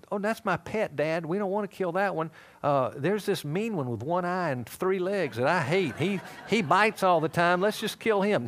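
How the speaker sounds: high, hammed-up child protesting to Dad; pleading little boy. Little Levi pleads to spare pet.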